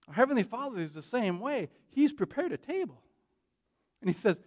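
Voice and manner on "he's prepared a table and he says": ordinary talk, nothing marked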